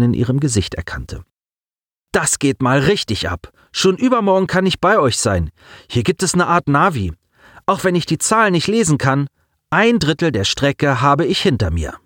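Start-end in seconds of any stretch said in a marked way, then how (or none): 2.1-11.9 put-on high-pitched, enthusiastic boy; thrilled youngster reporting over a comm; excited boy. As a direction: brag eagerly about the ride, voice up high. Boy's lines in narrated story.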